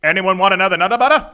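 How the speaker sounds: rattled off fast; fast tongue twister. Shown as a demonstration. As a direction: race through the tongue twister. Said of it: "Say that three times".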